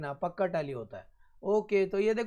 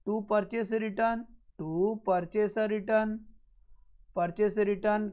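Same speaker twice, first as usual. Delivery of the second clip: very slow, drawn-out words; slow dictation; much slower than usual. Real, not acted. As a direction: dictate it slowly for note-takers. Teacher dictates the entry while writing. (धीरे-धीरे लिखवाते हुए)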